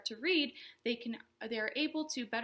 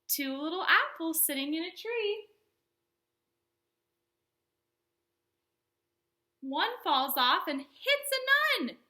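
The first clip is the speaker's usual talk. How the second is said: storytime rhyme; 4 semitones higher, 3 dB louder than usual. Acted. Reads counting rhyme to kids.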